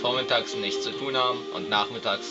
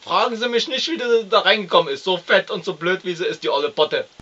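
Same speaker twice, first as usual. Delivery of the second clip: loud, higher, rambling lout; blustering boor ranting about his wife; crude husband; 10 semitones higher, 8 dB louder than usual. Acted. Plays the crude husband Herr M.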